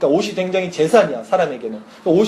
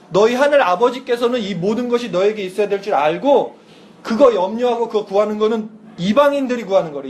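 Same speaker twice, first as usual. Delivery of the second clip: ordinary talk, nothing marked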